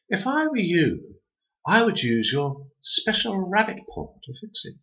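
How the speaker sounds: high, sly advice; twin voice. A narrator's reading. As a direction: suggest it slyly. Twin advising.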